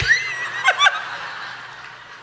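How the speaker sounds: high squealing laugh. Real, not acted. Preacher cracking up at his story. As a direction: burst out laughing.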